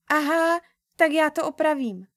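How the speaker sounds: put-on a girl catching on and rushing to mend it; hurried. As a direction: blurt it out eagerly to fix the mistake. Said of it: "řekla honem".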